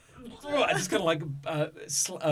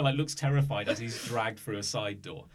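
normal talking voice

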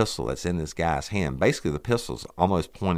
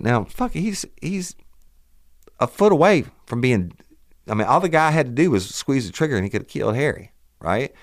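worked up; 11 semitones higher, 6 dB louder than usual. Real, not acted. Teller relives the danger.

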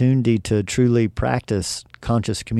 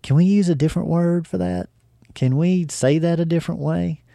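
lightly imitated friend, pitched higher; higher-pitched questioning quote of a friend; friend's polite objection; 6 semitones higher than usual. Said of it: "word that she would say".

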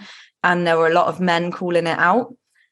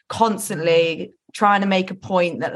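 ordinary talk

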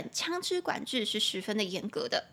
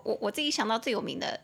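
ordinary delivery

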